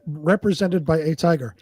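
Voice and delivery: ordinary talk, nothing marked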